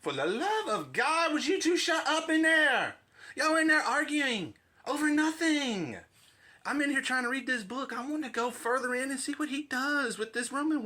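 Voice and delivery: high, loud, exasperated yelling; annoyed yelling roommate. Acted. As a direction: yell through the wall to shut them up. Third voice yells from next room.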